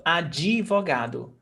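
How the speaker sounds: advogado with an extra I, a-dji-vo-GA-do; added I sound. Shown as a demonstration. Teacher shows student the unwritten vowel, added I sound. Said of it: "Even though we don't have that".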